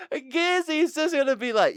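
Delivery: high giggling voice while speaking; words squeezed out through laughter; laughing through words. Real, not acted.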